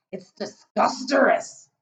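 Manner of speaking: gurgled with mouth full; gurgling. Acted. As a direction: gurgle it through food. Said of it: "the B F G gurgled".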